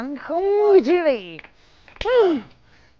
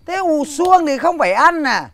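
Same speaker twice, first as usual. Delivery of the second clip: a loud, higher retort flung back at his wife; angry shout; 3 semitones higher, 7 dB louder than usual. Acted. Husband shouting back at his nagging wife, angry shout. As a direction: bark it back at her, louder.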